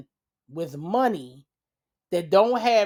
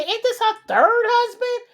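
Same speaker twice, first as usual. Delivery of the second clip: incredulous question; 6 dB louder than usual. Retold or imitated. Recalls what she nearly said.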